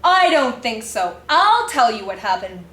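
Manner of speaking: usual speaking voice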